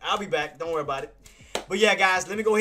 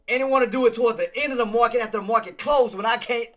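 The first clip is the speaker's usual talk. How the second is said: ordinary delivery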